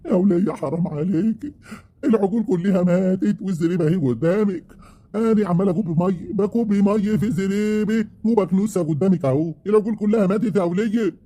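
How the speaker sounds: high, tearful pleading with his wife; wailing protest. Acted. Husband protests the calves all died.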